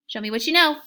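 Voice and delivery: spring the challenge with a gasp; excited challenge. Acted. Teacher daring kids after a gasp.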